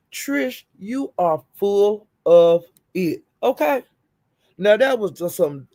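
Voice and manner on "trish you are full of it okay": slow, word-by-word verdict; emphatic call-out. Real, not acted. Telling Trish off for faking, slow and emphatic. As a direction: space out every word for emphasis.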